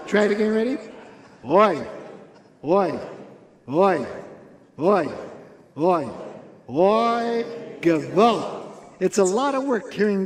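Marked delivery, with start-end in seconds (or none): 1.4-6.1 loud, regular groaned oi; oi with feeling. Shown as a demonstration. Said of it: "say it with feeling". 6.7-8.6 loud gewalt. Shown as a demonstration. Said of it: "You gotta say the Gewalt loudly".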